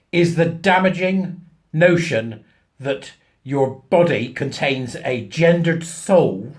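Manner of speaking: each word laid down slowly; slow emphatic. Real, not acted. Explaining to viewers, slow emphatic delivery. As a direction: spell it out deliberately, slowly.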